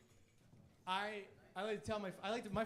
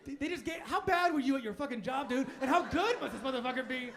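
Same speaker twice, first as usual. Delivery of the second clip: ordinary talk, nothing marked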